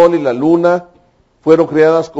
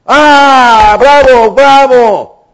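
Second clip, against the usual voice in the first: high, loud, overjoyed cry of praise; jubilant angels shouting; 8 semitones higher, 5 dB louder, much slower than usual. Acted. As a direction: shout for joy like angels seeing the new world. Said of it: "los ángeles la alzaron, la, gritaron".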